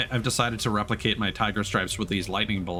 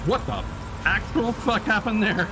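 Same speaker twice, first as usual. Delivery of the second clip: high, incredulous yelp at the screen; can't believe what just happened; stunned outburst; 11 semitones higher than usual. Real, not acted. Gamer reacting to a sudden wipeout, incredulous and high.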